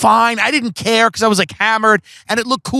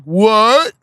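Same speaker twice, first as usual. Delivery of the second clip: higher-pitched, rising what; mock bafflement; 4 semitones higher, 4 dB louder than usual. Host hears his costume plan, mock bafflement. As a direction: imitate her baffled reaction, pitched up. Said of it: "she's like".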